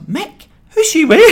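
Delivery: very high, incredulous squeal, acted; mock outraged disbelief. Retold suspicion on hearing Mick.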